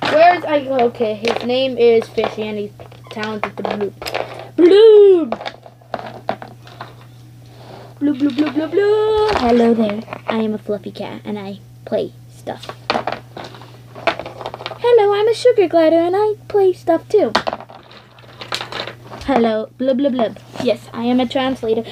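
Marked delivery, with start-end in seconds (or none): 4.5-9.3 high loud bloop; loud fish bloop. Pretend. 14.8-17.3 high, fast, loud squeak; high squeaky voice. Pretend. Sugar glider toy.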